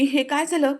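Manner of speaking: उंच, जोरात घाबरलेला प्रश्न; धक्का बसल्यासारखं विचार; घाबरून. Retold or imitated. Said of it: "तो खूप पॅनिक झालेला असतो". (बायकोला पडलेलं पाहून घाबरलेला युगंधर)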